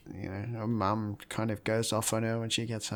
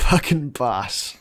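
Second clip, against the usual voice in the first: ordinary talk, nothing marked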